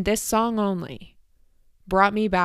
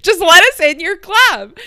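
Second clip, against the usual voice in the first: much higher, loud whiny plea; exasperated plea; 10 semitones higher, 10 dB louder than usual. Retold or imitated. Friends begging bouncers to let them in, loud high whine. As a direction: beg loudly like a kid.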